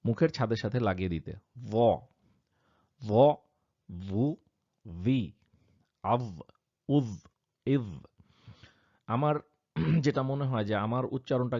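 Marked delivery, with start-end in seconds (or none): 1.7-3.3 য pushed hard, tongue pressed to the palate and louder; strong emphatic ظ; pressed emphatic zah. Shown as a demonstration. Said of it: "আমি চেষ্টা করব যতটুকু পারি জিভটাকে ঠেলে". 3.9-7.9 ভু ভি আভ উজ ইজ drilled one by one; vowel drill on zah. Shown as a demonstration. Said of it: "চেষ্টা করব".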